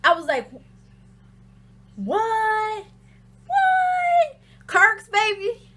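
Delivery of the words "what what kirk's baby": high, stretched squeals of astonishment; acted disbelief. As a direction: re-enact shock, voice leaping right up. Re-enacting shock at the gossip.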